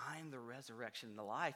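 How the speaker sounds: soft, low, quick; Jesus answering the grieving sister quietly; quiet quote. Retold or imitated. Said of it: "he says".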